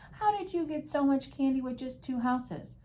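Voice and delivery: high-pitched, mildly incredulous question; lightly acted disbelief; puzzled officer demanding an explanation, pitched high; incredulous policeman.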